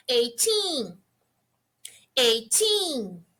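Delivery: put-on Eighteen with the push on the final teen; stress the ending. Shown as a demonstration. Teacher showing students the stressed end of Eighteen. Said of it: "você força um pouquinho no final".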